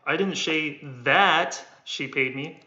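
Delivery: "that" lifted, slow; weight on "that" in the sentence; stress on that. Shown as a demonstration. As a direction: lean on "that". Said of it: "I'm only stressing the one that I'm holding".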